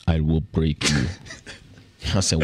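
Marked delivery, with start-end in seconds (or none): 0.1-1.1 low, menacing mock Russian accent; hammed-up Russian tough guy. Mitch's attacker hits him with a threat, in a Russian accent. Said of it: "came up to me with a Russian accent".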